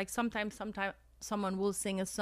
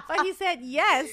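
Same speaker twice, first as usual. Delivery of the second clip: laughing; 8 semitones higher, 7 dB louder than usual. Real, not acted. Laughing at her own luck.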